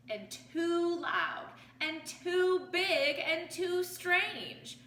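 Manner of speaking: each "too" leaned on, drawn out slowly; slow stressed list. A narrator's reading. Reading to children, slowly stressing each "too". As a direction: stretch every "too" for the children. Slow listing of frightening things.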